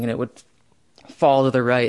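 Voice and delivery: normal talking voice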